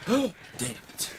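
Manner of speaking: gasp, then high-pitched pretend dismay; mock outrage.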